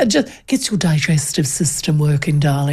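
posh English grandmother. Retold or imitated. A touch of posh English grandmother, talking about evening walks.

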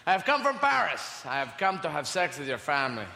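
mock French Pierre, lightly done; a hammed-up Frenchman introducing himself; French charmer accent, lightly played. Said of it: "turn up and go".

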